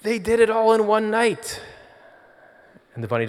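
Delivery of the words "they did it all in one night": high, louder elated exclamation; overjoyed Scrooge. Acted. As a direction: burst out with amazement. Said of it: "And he says".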